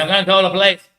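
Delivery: retold threat, high and loud. Shop worker threatens caught thief, loud imitation.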